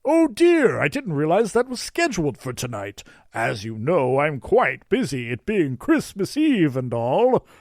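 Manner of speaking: high, loud, hammed-up Santa act; getting into character. A narrator's reading. Nicholas plays busy Santa on Christmas Eve, hammed-up. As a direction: put on a fussy, theatrical Santa. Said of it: "getting into character".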